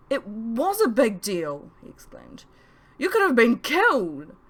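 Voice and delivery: high, loud self-blaming anguish; loud, high guilty lament; distraught groan. A narrator's reading. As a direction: berate yourself near tears, slowing at the end. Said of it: "he groaned".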